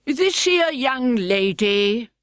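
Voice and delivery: slow, drawn-out coarse griffin. Acted. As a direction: lean into the rough griffin.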